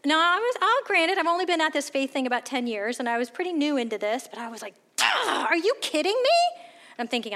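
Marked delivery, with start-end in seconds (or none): 5.0-6.6 high, scoffing are you kidding me; mock her own snippy disbelief; sarcastic, much higher; incredulous retort.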